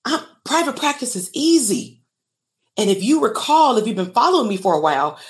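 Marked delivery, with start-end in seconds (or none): 0.0-2.0 mock naive therapist. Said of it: "they like".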